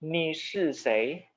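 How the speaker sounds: Ni shi sei said very slowly, syllable by syllable; teacher modelling a Mandarin question slowly; slow example sentence. Shown as a demonstration. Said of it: "Contoh seperti ini".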